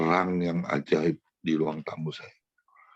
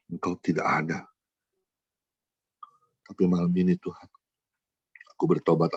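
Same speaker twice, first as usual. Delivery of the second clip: retold prayer. Retold tearful prayer of repentance.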